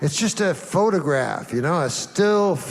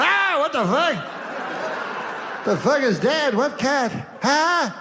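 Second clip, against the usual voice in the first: high-pitched mock alarm; hammed-up bewildered yelp; acted shocked son; 9 semitones higher than usual. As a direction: play a startled son, voice jumping up high. Acts son walking in on body.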